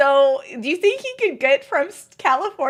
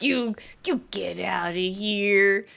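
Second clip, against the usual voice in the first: slow, low playful voice to a puppy; dad's doggy voice; 5 semitones lower, 5 dB quieter, slower than usual. Retold or imitated. Daughter imitating dad teasing the puppy, slow and playful. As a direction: coo at the puppy like her dad does. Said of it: "And he's like".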